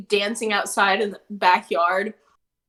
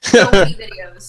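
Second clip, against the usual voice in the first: loud, lower put-on comic voice; Mitch Hedberg; 4 semitones lower, 9 dB louder than usual. Teased by a friend for the delivery, low loud put-on voice. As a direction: drop the pitch and push it out loud. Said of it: "Okay, Mitch Hedberg".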